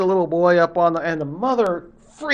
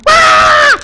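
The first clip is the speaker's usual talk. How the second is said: much higher, louder scream of fright; terrified scream; 14 dB louder than usual. Acted. Woman seeing a giant bear, screaming in terror. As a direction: shriek at the sight of the bear. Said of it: "And she goes".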